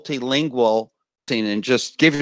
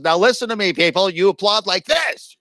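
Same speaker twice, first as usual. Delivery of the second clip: higher-pitched, bossy crowd instructor; studio warm-up person; 6 semitones higher than usual. Acted. TV warm-up person instructing studio audience how to applaud, mock-commanding. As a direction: address the crowd as the show's warm-up act. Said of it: "There's a person that comes in a half hour early and says".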